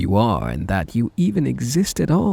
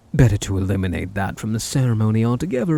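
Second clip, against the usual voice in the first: usual speaking voice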